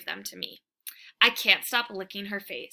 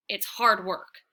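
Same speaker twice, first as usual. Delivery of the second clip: usual speaking voice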